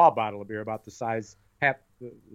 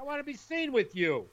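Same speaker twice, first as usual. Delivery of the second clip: mock teen; 13 semitones higher, 7 dB quieter than usual. Mocking a teen in car.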